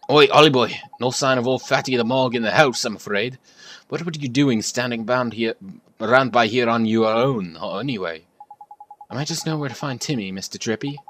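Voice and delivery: lightly voiced Welsh sidekick, loud hail; Welsh fairy. Welsh fairy greeting the boy with a light Welsh lilt. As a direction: hail him like a cheeky Welsh mate.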